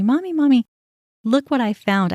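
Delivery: a hint of an eager child calling for her mom; excited little daughter. Ten-year-old daughter showing mom a found charm, lightly voiced excited child.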